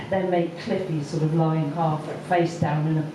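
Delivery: normal talking voice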